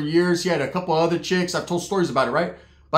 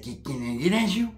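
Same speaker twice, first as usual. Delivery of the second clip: soft, odd put-on come-on; imitated hushed flirt; cousin's pickup voice; 8 dB quieter than usual. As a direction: slide the line out quietly as the cousin.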